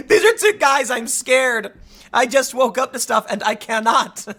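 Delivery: mock scared student. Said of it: "mocking texts".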